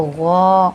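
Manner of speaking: "गौ" held long with a rounded "o"; long, full "गौ" coloured with "o"; heavy "o" sound. Shown as a demonstration. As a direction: fill the mouth on "गौ". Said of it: "ओ की sound आते हुए हम अदा करेंगे".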